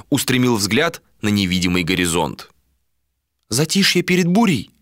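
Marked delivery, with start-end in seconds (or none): 3.5-4.7 higher female character, questioning; lightly voiced woman. A narrator's reading. Woman speaks beside him, lightly voiced higher question. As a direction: raise the pitch a little for her. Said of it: "прозвучал рядом голос Малики".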